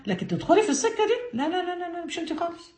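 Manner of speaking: mock devil's alarm. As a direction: raise the pitch, then drop to a near hush on لا لا. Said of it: "ده مين اللي بيقول لها كده؟ الشيطان".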